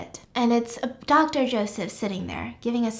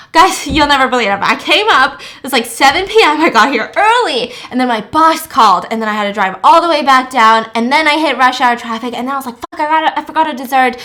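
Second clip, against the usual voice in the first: loud, fast, breathless-style rundown; excited explaining; 3 semitones higher, 11 dB louder than usual. Retold or imitated. Choi explains to guests why he's late, loud rushed storytelling. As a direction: rattle off excuses loudly and quickly.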